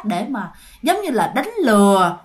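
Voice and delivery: ordinary delivery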